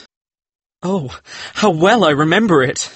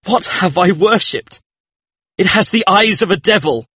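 very high, anguished exclamation; horrified outcry; 13 semitones higher than usual. A narrator's reading. Painter sees a devil in his work, horrified. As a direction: cry it out in high-pitched dread.

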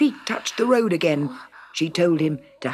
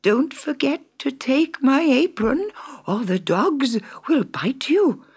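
higher, hissing crone's warning; high whispery witch; 8 semitones higher than usual. A narrator's reading. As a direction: warn him like a crafty witch.

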